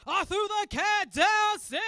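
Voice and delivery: slow, high, loud yell; shouted confession. Acted. Comic confessing what she did with the cat, slow shout. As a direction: belt out the punchline, stretched out.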